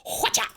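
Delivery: loud playful whoosh; dart sound effect. Retold or imitated. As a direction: shout a silly throw noise. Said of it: "I throw the dart and I go".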